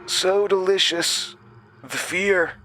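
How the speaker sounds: high, savoring purr; gloating villain. A narrator's reading. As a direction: taste their fear. Summers savoring fear.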